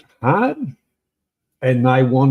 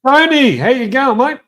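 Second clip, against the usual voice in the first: much higher, louder call across the room; 9 semitones higher, 4 dB louder than usual. Real, not acted. Greeting Tony as he arrives, higher and louder call. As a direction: hail a mate who just walked in.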